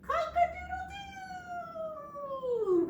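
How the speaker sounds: very high, slow drawn-out crow; rooster crow. Shown as a demonstration. Librarian acts out the rooster for kids, high long crow. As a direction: crow like a rooster waking everyone. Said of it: "Wake up and shout".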